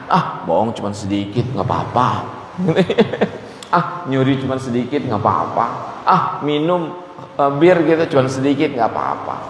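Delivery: dismissive mock sinner, low and soft; careless sinner voice. Mocking careless sinners' excuses.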